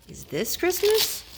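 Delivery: high, slow wondering owl; little owl voice. A narrator's reading. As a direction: ask it like a small lost owl. Owl voiced in read-aloud story.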